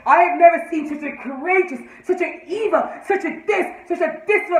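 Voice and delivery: high, overblown declaiming; hammed-up mock condemnation; mocking the judge. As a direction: imitate a pompous judge, pitch climbing.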